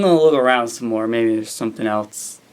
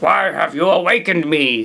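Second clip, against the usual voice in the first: monster voice; 4 semitones higher than usual. Acted. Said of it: "said the monster".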